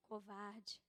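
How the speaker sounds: a low, quiet, accusing voice from above; God's voice. Retold or imitated. God calling the pastor a coward, low and quiet. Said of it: "aí eu ouvia sempre".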